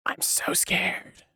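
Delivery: breathy whisper of fake terror; hushed sarcastic whisper; whispered mock fear. Shown as a demonstration. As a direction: whisper it, pretending to tremble.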